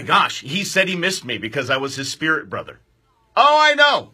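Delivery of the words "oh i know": loud, high giddy admission. Real, not acted. Host caught name dropping, giddy and loud. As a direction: own the name drop with a squeal.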